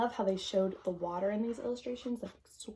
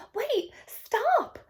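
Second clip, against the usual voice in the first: high, loud cry of alarm; 10 dB louder than usual. Acted. Girl calling to the monkey stealing her stars, a high alarmed cry. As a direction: shout after the fleeing thief. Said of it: "the girl cried".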